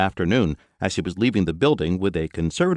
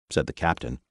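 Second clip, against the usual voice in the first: plain reading voice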